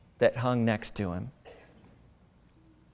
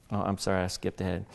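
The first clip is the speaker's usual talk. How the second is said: normal talking voice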